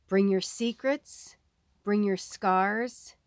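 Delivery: slow, soft reading of a card message; slow soft oracle reading. Acted. Reader voicing the card to viewers, slow and soft. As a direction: read it like a gentle oracle speaking.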